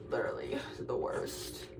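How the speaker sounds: a low, breathless whispered aside. Real, not acted. Grumbling to viewers about studying, whispered. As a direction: mutter it under your breath.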